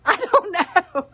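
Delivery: slow, high laughing words; laughing off whether she's a little off. Real, not acted. Laughing self-doubt about humor.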